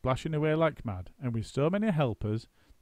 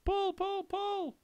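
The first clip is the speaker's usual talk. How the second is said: very high yell; very high yelled pull; much slower than usual. A narrator's reading. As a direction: yell the order. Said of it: "yelled Mugglewump".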